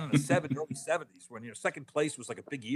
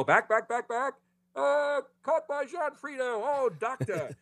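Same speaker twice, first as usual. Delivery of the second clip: much higher, excited radio play-by-play; Red Barber call; 12 semitones higher, slower than usual. Retold or imitated. Recreating the famous radio call for the hosts, excited announcer. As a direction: call the catch like an old-time announcer. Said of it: "heard Red Barber go".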